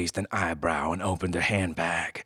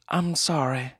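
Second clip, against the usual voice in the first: apologetic woman; 8 semitones higher, 3 dB louder than usual. Acted. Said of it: "she said".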